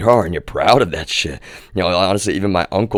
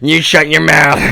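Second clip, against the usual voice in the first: higher, loud, slow snapping order; mock grandma scolding; 8 semitones higher, 7 dB louder, slower than usual. Imitating grandma telling him to hush, loud and higher. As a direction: bark it like a cranky grandma. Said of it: "She's like".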